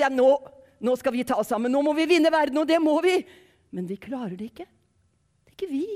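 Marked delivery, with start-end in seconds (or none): 0.0-3.2 mock pep talk. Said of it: "Vi skal ikke si".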